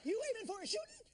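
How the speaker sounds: high, loud put-on celebrity voice; hammy celebrity impression in a freeway scene, high and pushed. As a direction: squeal it in a high, strained impression. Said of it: "do a different impression".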